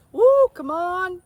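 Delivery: high, loud, drawn-out holler; cattle call. Shown as a demonstration. Wife shows the family call, hollered high and long. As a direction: holler for cows miles around. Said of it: "Same with ours".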